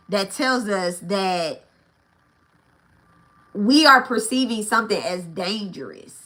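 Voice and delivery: slow, deliberate delivery of each word; said slowly. Shown as a demonstration. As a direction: spell it out slowly for emphasis. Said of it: "I have to say that slowly".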